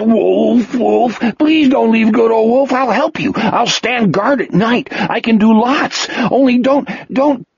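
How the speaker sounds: a sobbing plea, voice way up; weeping plea. Acted. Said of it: "Wolf wept".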